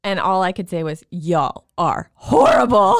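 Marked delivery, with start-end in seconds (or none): 1.1-2.9 slow, louder mock complaint; mock grumble. Mom in labor griping at the people dragging her, slow and loud. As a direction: drag it out like a cranky patient.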